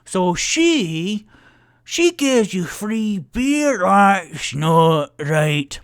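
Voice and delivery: slow, loud, high angry bellow; Thornan, angry dwarf. Acted. Angry dwarf dismissing the bartender, slow loud bellow. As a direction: roar it out, stretching the words. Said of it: "And then Thornan".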